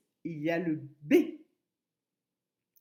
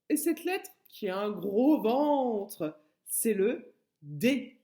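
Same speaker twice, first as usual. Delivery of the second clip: slow drawn-out reveal; 5 dB louder, slower than usual. Shown as a demonstration. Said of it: "teacher stretches the reveal".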